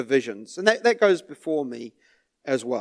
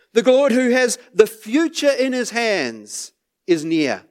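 preaching; 6 semitones higher than usual. Acted. Rising sermon climax.

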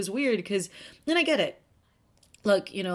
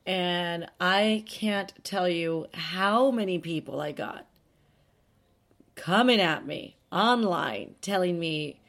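normal talking voice

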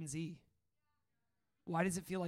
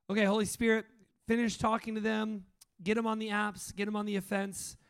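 fervent prayer; 4 semitones higher, 5 dB louder than usual. Real, not acted. Pastor prays over crowd.

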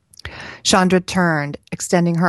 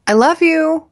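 high, raised sing-out call; 13 semitones higher, 6 dB louder than usual. A narrator's reading. Mother leaving for the bus, calling out to daughter. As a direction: call it back from the porch, much higher and louder. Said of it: "Chandra called as she stepped off".

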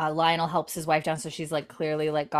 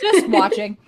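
laughing; 3 semitones higher, 8 dB louder than usual. Real, not acted. Laughing recap.

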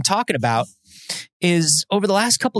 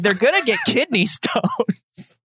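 mock doctor warning; 8 semitones higher, slower than usual. Doctor voiced in retold checkup.

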